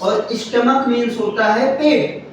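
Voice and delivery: ordinary talk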